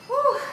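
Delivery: high-pitched "woo"; exertion whoop. Real, not acted. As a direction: whoop out the effort. Whooping while recovering from set.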